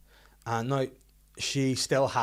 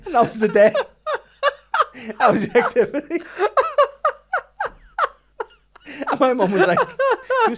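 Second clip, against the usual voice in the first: much higher, louder words through laughter; real helpless laughter; laughing hard; 12 dB louder than usual. Real, not acted. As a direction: giggle through every word. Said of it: "cracking up at punchline".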